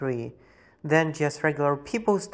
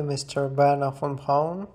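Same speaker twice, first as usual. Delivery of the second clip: ordinary talk, nothing marked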